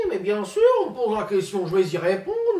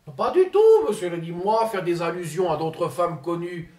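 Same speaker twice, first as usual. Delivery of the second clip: pompous politician impersonation; Ségolène Royal impression; 2 semitones higher than usual. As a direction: play the self-important minister, lofty and indignant.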